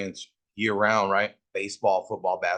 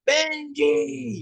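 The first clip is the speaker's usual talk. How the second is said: high calling-out of a name; mom calling. Retold or imitated. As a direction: call the kid home from far off, pitched way up. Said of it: "She would yell and shit".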